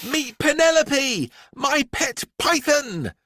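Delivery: put-on high bright little-girl voice, proudly; Miranda announcing. A narrator's reading. Little girl introducing her python to the class, proudly. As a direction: show off her pet like a prize. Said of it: "announced Miranda".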